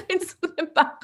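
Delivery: high squeal breaking into laughter; comically high, giggly retelling; mock plea. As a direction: pipe it up high while laughing. Repeats the retold cry, laughing.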